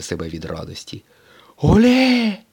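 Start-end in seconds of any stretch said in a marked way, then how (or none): 1.6-2.4 put-on very high, loud girlish cheer; cheering exclamation. A narrator's reading. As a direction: squeal it out like a fan in the stands. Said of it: "вигукнула Мія".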